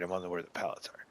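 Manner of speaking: quick whispered aside. Real, not acted. Player realizes the wrong palette, whispered aside. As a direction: rush it under breath.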